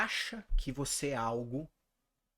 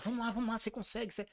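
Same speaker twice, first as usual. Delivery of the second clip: encouraging cheer; 8 semitones higher, much faster than usual. Retold or imitated. Boy cheering teammates.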